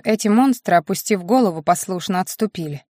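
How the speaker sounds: plain reading voice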